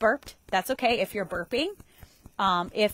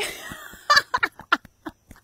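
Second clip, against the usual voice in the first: high burst of laughter; loud laugh; 6 dB louder than usual. Real, not acted. Laughs at own lighting comment.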